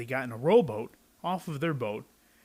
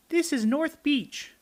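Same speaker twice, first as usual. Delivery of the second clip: put-on much higher, bright little boy; high child voice; boy's voice; 13 semitones higher, 4 dB louder, slower than usual. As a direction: answer like a small child. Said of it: "I said".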